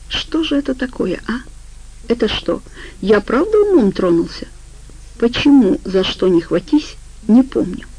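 ordinary delivery

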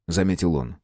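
ordinary delivery

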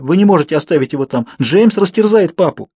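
high, urgent protest; alarmed cry. A narrator's reading. Girl begging them not to abandon her father, alarmed. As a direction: cry out in fear for him. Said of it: "закричал я".

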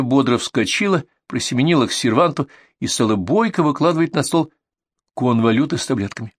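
ordinary delivery

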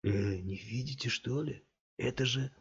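hushed. A narrator's reading. Thug sly near Scott.

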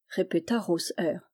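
ordinary delivery

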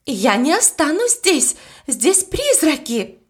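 gasping out the refusal; breathless panic. A narrator's reading. Cook declares she won't stay, mildly breathless with fright. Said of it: "чуть ли не задыхалась".

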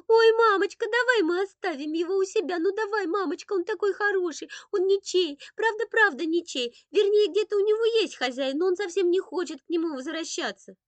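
put-on high, loud childlike begging; pleading little boy. A narrator's reading. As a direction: beg mum excitedly in a small boy's high voice. Boy begging mother to keep kitten.

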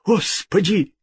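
high-pitched terrified plea; cry of horror. A narrator's reading. King begging God's forgiveness, horrified. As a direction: beg for mercy, drawn out and high. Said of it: "вскричал в ужасе король".

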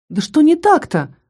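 sharp high indignant cry; angry. A narrator's reading. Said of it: "разозлилась Кара".

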